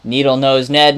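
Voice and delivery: ordinary talk, nothing marked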